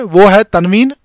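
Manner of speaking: ordinary delivery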